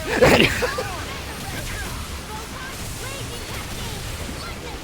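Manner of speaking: long high-pitched cackle, rising. Real, not acted. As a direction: let a long cackle rise up. Laughs at insult joke.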